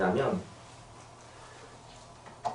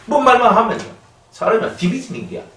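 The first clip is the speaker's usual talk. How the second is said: mocking; 9 semitones higher, 4 dB louder than usual. Mocking touchy believers.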